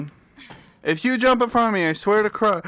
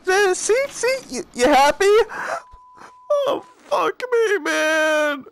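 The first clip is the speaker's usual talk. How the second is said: frightened whine; 9 semitones higher than usual. Real, not acted. Girl still behind him.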